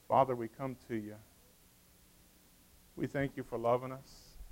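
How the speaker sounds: hushed, low prayer voice. Real, not acted. Pastor praying over everyone in the room, hushed prayer. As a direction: pray softly and low, with long pauses.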